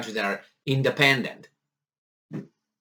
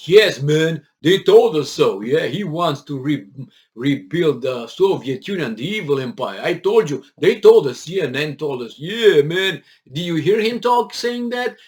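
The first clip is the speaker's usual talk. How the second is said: gullible believers; 6 dB louder than usual. Retold or imitated. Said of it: "and people will be".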